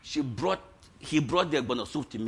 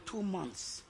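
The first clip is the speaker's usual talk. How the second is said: much softer, low punchline; quiet; 3 semitones lower, 13 dB quieter than usual. Real, not acted. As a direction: drop it to a hush. Sermon punchline.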